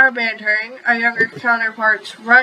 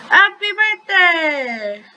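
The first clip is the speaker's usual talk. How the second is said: high, drawn-out happy cheer; childlike birthday cheer, pitched high; excited cry; 7 semitones higher, much slower than usual. A narrator's reading. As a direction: cry it out with delight, stretching the words. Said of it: "they cried out to each other".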